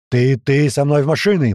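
loud, curt grumbled command; gruff order. Acted. Boatswain picks helpers for engine room, gruff order. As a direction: pick men out gruffly. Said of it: "буркнул боцман".

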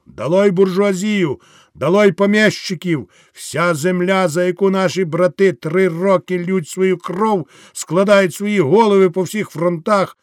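rally the peasants with rising passion; soldier's speech to the crowd; fiery soldier orator. Acted. Wounded officer rallying the crowd.

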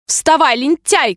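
high, imperious wake-up call; shouted order. A narrator's reading. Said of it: "крикнула королева". (повелительный окрик)

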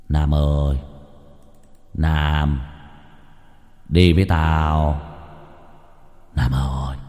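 low, slow, eerie ghostly call. A narrator's reading. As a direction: drag the name out like a spirit from beyond. Ghost calling Nam in dream.

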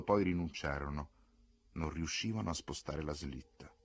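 ordinary delivery

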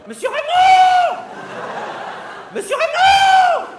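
very high, loud, slow shriek across the shop; screaming for the boss. Acted. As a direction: howl for the manager at full voice. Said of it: "hurla-t-elle". (hurlement très aigu et fort pour appeler le chef)

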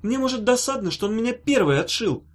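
high, sharp irritation; irritated. A narrator's reading. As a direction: snap at him, annoyed. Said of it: "сказала Ната с раздражением".

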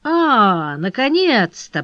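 ordinary delivery

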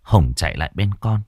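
plain reading voice

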